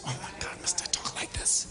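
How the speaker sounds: whispered aside to God; hushed aside. Real, not acted. Preacher questions God mid-sermon, hushed aside. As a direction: drop to a near-whisper, as if praying privately.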